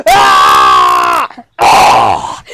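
hoarse, drawn-out play screams; rasping cries of mock agony; disgusted screams. Toy forced to smell butt crack, hoarse screams.

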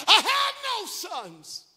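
high, drawn-out wail of protest; yelling at God. Retold or imitated. Preacher acting out Naomi-like complaint to God, yelling. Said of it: "I was yelling at him".

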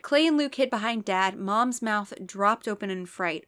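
normal talking voice

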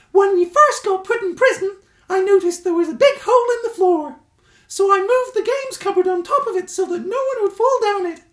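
high, loud squeaky badger voice; helpful Stuart. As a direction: chirp it up brightly and helpfully.

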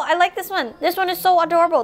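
ordinary talk

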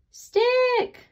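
high, loud call across the distance. A narrator's reading. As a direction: call for a lost friend. Searching, calling for Stick.

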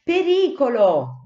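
drawn-out loud high cry of Pericolo; alarm shout. Acted. The lookouts warning the feeding sparrows, a loud drawn alarm. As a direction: yell the warning slowly together. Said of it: "gridarono tutti insieme".

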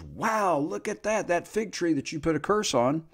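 amazed Peter. Retold or imitated. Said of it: "and Peter says".

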